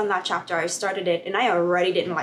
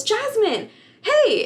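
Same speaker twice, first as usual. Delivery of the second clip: much higher, bright hail of Jasmine, hey; cheerful call out; 13 semitones higher, 4 dB louder, much slower than usual. Retold or imitated. Professor calls to Jasmine passing in the hallway, high and cheery. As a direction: sing out the greeting.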